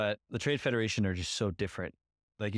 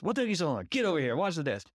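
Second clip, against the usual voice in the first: higher, pushy mock scolding; impatient boss; 7 semitones higher than usual. Podcaster imitating Watto scolding Anakin, mock impatient. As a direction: order the kid around like he's late. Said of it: "you see his anger a little bit more, that impatience".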